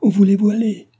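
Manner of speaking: crying out. A narrator's reading. Delville following Cécile who will not answer, crying out. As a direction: shout it after her, pitched right up. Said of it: "s'écria Delville en la suivant".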